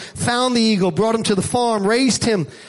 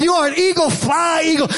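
higher, urgent storyteller's climax; rousing command; 4 semitones higher than usual. Acted. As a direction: urge the eagle to take off, pitched up. Naturalist's final urging on mountain.

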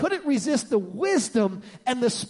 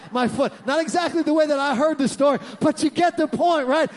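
ordinary delivery

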